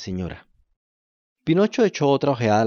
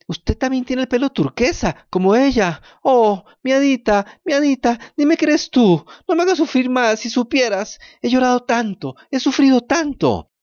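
tearful pleading; 13 semitones higher, 6 dB louder than usual. Acted. Pinocho begs his fairy to reveal herself, high and tearful. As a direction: beg her through tears to admit it. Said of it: "Pinocho lloraba incontrolablemente".

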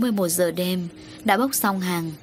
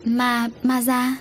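slow, higher-pitched halting question; frightened stammer; 6 semitones higher, slower than usual. A narrator's reading. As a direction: falter on the dreaded name, lightly acted fear. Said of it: "Hồng Hạnh tái mặt".

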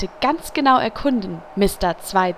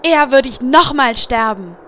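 loud retort; 2 semitones higher, 7 dB louder than usual. Acted. Priestess retorts in the quarrel.

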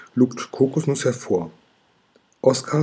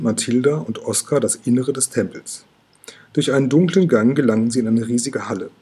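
plain reading voice

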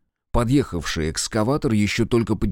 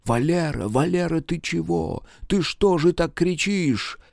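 worried wife; 8 semitones higher than usual. Acted. Wife wakes him.

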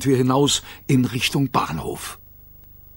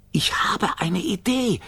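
slow, high little creature; tiny creature voice; 6 semitones higher, 4 dB quieter than usual. A narrator's reading. Gigglerin offering an idea, slow high voice. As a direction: announce it in a small high voice, drawn out.

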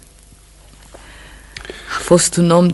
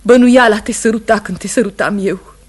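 loud, higher cry of protest; anguished outburst; 4 semitones higher, 9 dB louder than usual. Acted. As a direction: burst out in anguish. Wife confesses her coldness.

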